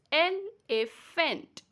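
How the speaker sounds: Elephant in three separate beats; el-e-phant, a small gap between beats of Elephant; split into syllables. Shown as a demonstration. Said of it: "That means there are three beats".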